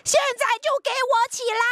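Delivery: high, fast furious scold; angry mother scolding. Acted. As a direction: snap at the sleepy child. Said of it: "青蛙妈妈终于忍不住生气了".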